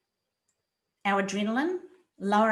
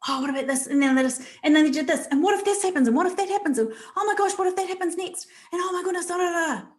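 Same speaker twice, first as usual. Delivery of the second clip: higher, spiralling worried voice; anxious racing thoughts; 8 semitones higher, faster than usual. Retold or imitated. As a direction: climb higher with every what-if. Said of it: "We need to stop the racing thoughts".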